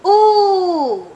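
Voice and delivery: high, drawn-out excited ooh; loud drawn-out ooh. Real, not acted. Reader squeals at the granny's line, high and drawn out. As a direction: let out a long delighted ooh. Reacting to the story.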